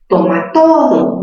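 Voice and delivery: toma todo drawn out slowly on TO; stress on TO. Shown as a demonstration. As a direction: lean hard and slow on the TO of todo. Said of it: "En la sílaba to". (toma todo alargando TO de todo)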